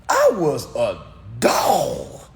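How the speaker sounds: slow, loud, drawn-out mock outrage; indignant mock shout. Streamer repeating what he was called, indignant shout. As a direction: stretch each word into an indignant holler.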